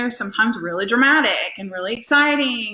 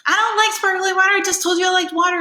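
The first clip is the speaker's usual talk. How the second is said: high, louder imitation of a displeased guest; mock complaint; 9 semitones higher, 4 dB louder, faster than usual. Guest scolds her over the sparkly water, mock indignant. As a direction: play the guest who won't be pleased.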